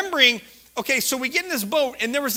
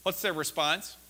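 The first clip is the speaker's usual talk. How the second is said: ordinary talk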